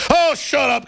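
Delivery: high, scoffing mock retort; mock dismissal. Critics dismissing his Saudi warning, mock scornful.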